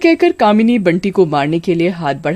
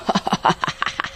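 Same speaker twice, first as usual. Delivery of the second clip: breathy witch laugh; 7 dB quieter than usual. Acted.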